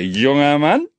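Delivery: lauter, scharfer Anpfiff; angeblafft. A narrator's reading. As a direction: blaff den Kleinen an. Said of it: "blaffte er den Kleinen an". (laut angeblafft)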